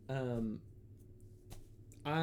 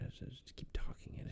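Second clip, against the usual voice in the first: quick hushed plotting; plotting whisper; 10 dB quieter, faster than usual. Retold or imitated. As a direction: whisper the plan quickly, softer. Whispered plan continues.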